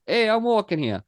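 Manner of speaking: put-on New York line in an Italian accent; lightly hammed Mario-style Italian. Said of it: "suddenly I'm fucking Mario".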